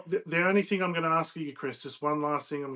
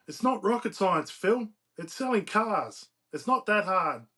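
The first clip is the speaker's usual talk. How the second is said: higher, exasperated character line; higher-pitched, impatient telling-off; exasperated lecture; 6 semitones higher, 6 dB louder, slower than usual. A narrator's reading. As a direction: lecture a hopeless friend. Character scolds friend in story.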